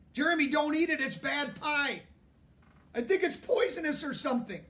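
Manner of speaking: urgent frightened plea; panicked. Acted. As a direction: blurt it out in a panic, high. Panicked warning to friend.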